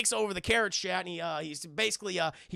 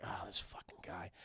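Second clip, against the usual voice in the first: very low, whispered grumble; acted hushed, weary aside; muttered disgust; 13 semitones lower, 17 dB quieter than usual. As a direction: mutter it under your breath. Brother grumbles at seeing Tuco.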